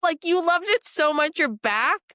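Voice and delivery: high, loud fake delight; mock-sweet server. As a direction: beam through a forced polite welcome. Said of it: "And I was like".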